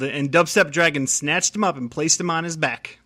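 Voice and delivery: usual speaking voice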